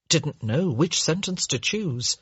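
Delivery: ordinary delivery, nothing marked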